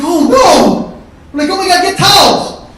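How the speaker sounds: high, loud mock panic; panicked yell. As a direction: yell for help like a scared kid. Narrator panics as kid.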